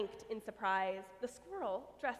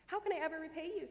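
grateful rush; 6 semitones higher, faster than usual. Acted.

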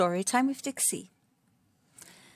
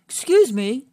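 louder, higher polite call; a lightly voiced weak lion calling out; 5 semitones higher, 9 dB louder than usual. Lion calling to Grass Mouse for help, louder.

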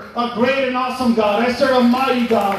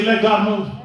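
call to repeat; 3 dB louder than usual. Shown as a demonstration. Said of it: "Come on, say".

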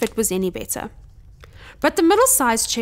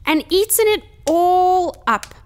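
small squeaky cub voice, louder on the last words; tiny squeak; 8 semitones higher, 4 dB louder than usual. A narrator's reading. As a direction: squeak it like an outraged little bear. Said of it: "squeaked Baby Bear in his thin, tiny voice".

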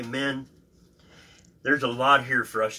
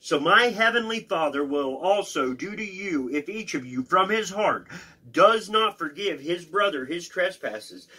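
raised, emphatic Bible reading; scripture reading; 6 semitones higher, 4 dB louder than usual. Acted. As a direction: read the parable out with force. Rereads verse aloud.

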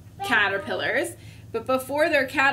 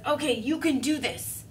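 self pep talk; 3 semitones higher than usual. Acted. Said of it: "He says".

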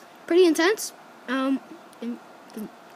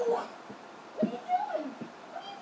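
squeaky high voice; 14 semitones higher, 14 dB quieter, faster than usual. Acted.

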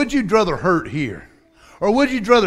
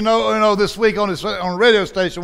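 ordinary talk, nothing marked

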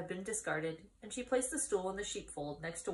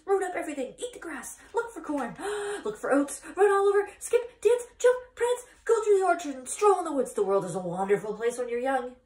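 rising, louder and higher goose, building to a frenzy; excited goose; 7 semitones higher, 10 dB louder than usual. Acted. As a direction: honk faster and wilder as the list grows. Goose lists wild freedoms, building.

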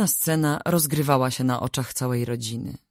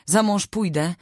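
high, raised voice of protest; defiant cry; 8 semitones higher, 4 dB louder than usual. A narrator's reading. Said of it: "krzyknęła". (podniesiony głos, okrzyk sprzeciwu)

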